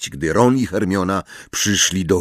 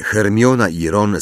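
ordinary delivery, nothing marked

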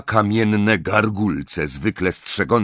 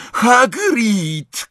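high-pitched shout of a name; high, sudden exclamation; startled cry; 14 semitones higher, 3 dB louder than usual. A narrator's reading. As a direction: cry out in surprise. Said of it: "krzyknął Harry".

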